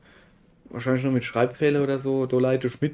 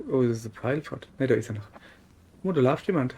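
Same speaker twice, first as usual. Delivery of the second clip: usual speaking voice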